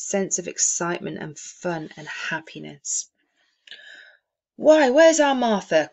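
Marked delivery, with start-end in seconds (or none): 4.6-5.9 higher, louder father calling; cheery father's call. A narrator's reading. Bob calling out for his daughter, cheerfully. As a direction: call out cheerfully as a happy dad. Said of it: "cried Bob Cratchit".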